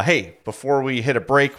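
usual speaking voice

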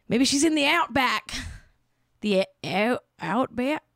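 slipping, badly faked Australian accent; Australian accent attempt. Shown as a demonstration. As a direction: try an Aussie accent and lose it. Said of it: "I did the accent, then I lost it".

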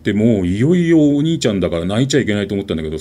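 ordinary delivery

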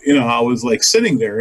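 ordinary delivery

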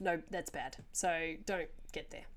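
ordinary delivery

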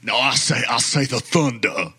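hammed-up Foghorn Leghorn Southern accent. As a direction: put on a blustery cartoon Southern accent. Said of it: "in a Southern accent".

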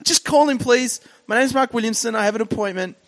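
high, strained comic pleading; acted desperation. Frightened visitor begging the receptionist to call the lawyer, high desperate plea. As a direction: plead in a panicky high voice, easing slightly.

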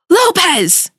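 high-pitched imitation of an irate boss; mock angry yell. Boss calling radio host on the hotline, mock angry yell. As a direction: yell the surname like a fuming boss.

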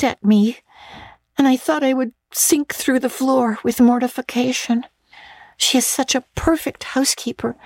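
put-on a breathless young girl confiding; Anne's girlish voice. Girl confiding a mortifying dinner to her friend, eager. Anne continues to Diana.

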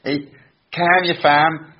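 high-pitched attempt at Scouse, a bit Welsh; bad Scouse accent. Podcaster testing their Scouse on a Liverpool guest, bad Scouse accent. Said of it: "my awful accent".

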